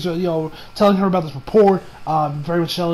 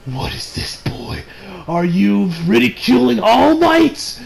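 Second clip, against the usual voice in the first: Sir Night Eye; slower than usual. Retold or imitated. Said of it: "Sir, Sir Night says".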